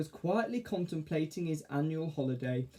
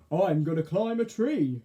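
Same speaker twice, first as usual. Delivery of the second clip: enthusiastic; 4 semitones higher, 6 dB louder than usual. Acted. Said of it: "said Arthur enthusiastically".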